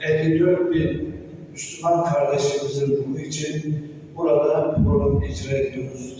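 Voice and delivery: normal talking voice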